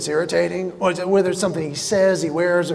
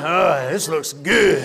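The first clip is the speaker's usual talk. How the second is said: low, slow, pleased with himself; mock-proud guy at the mirror, slow and low; smug self-admiration; 5 semitones lower, much slower than usual. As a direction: admire yourself in the mirror, drawn out. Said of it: "looked in the mirror and went".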